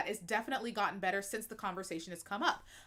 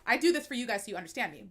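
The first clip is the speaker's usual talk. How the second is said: normal talking voice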